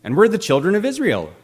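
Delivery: high, loud, eager cartoon vegetable voice; imitating a chirpy cartoon hero introducing himself; cartoon Joshua. Preacher imitating VeggieTales Joshua greeting guards, high and eager. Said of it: "Joshua greets them saying".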